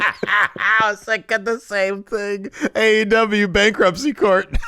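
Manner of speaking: laughing. Real, not acted. Joking about show name.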